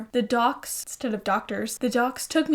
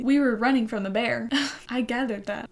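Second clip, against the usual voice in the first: ordinary talk, nothing marked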